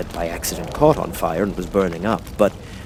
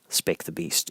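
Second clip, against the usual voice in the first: plain reading voice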